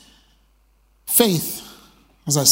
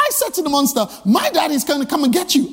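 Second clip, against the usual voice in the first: put-on excited child; 6 semitones higher, faster than usual.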